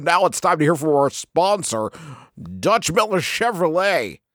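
high-pitched, hammed-up radio DJ patter. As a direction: overdo the announcer reading to a sponsor break. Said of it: "working out my radio voice".

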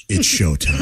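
put-on low cocky kid voice; swaggering. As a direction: announce it with swagger. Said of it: "I want you to".

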